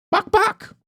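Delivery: high clucking bok bok; chicken clucks. Acted. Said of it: "a robotic voice, which is Saki".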